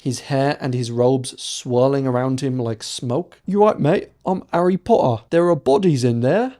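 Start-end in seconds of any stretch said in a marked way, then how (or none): 3.4-6.6 a lightly hammed-up British schoolboy accent, higher; mock British accent as Harry Potter, a touch louder. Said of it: "said Harry in the best Harry Potter accent possible".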